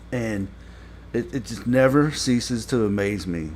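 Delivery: ordinary delivery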